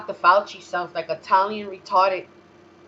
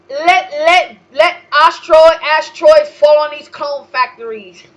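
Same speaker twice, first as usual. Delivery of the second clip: higher, much louder rant; excited shout; 8 semitones higher, 12 dB louder than usual. Real, not acted. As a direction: yell the wish with glee. Wishing destruction.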